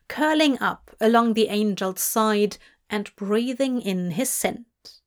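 ordinary delivery, nothing marked

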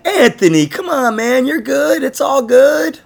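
hammed-up chummy buddy pep talk; mock friendly Chris. Teasing his co-host by imitating his half-hearted cheer. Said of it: "not so warm, kind of friendly way".

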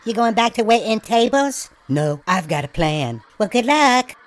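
excited gossiping coworker; high-pitched sketch voice. Acted.

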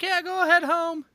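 high, dismissive doctor; goofy high voice. Retold or imitated. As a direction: brush him off in a squeak. Voicing the doctor.

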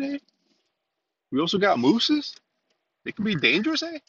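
a goofy, exaggerated Canadian with lots of eh; bad Canadian accent. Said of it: "that's a horrible Canadian".

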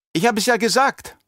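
put-on high, cheeky young girl voice. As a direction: tease him with a bright voice. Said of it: "meinte Alia keck".

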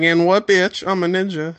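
high-pitched mock bragging comeback; mock cocky taunt. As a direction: throw it out as a playful taunt. Imitating Hanzo's cocky comeback.

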